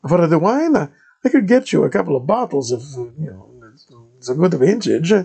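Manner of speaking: put-on Italian-accented padre greeting a stranger; thick Italian accent. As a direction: play the monk in a heavy Italian accent. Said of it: "the thickest Italian accent I'd ever heard".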